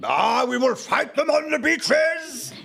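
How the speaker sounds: slow wartime prime minister voice; booming Churchill impression. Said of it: "to hear the Prime Minister say".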